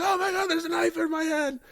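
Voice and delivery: very high panicked squeal, hammed up; mock freak-out. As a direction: act out discovering something horrifying. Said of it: "he starts freaking out".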